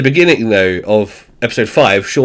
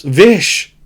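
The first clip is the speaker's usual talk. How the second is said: shouting; 7 semitones higher, much slower than usual. Retold or imitated. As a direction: yell the warning across the shop so he hears it. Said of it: "actually shouts".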